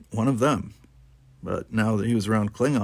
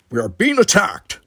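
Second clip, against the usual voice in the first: louder, firm Klingon voice; Worf's voice; 9 semitones higher, 7 dB louder than usual. Acted. Worf telling Tanya about the attack, firm and loud. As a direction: state it like a warrior.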